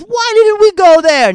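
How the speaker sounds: real fan frustration, shouted; frustrated shout. Real, not acted. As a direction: cry out at the bookers in disbelief.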